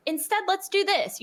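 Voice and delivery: sing-song redirect to a guest; cheery redirect. Retold or imitated. Park character redirecting a guest, high cheery voice. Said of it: "say, like".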